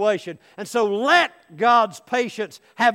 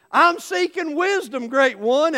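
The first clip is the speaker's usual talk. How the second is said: higher, louder acted plea; raised-pitch eager answer; urgent reply; 7 semitones higher, 5 dB louder than usual. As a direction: answer urgently, just up from underwater. Seeker answers after first dunking.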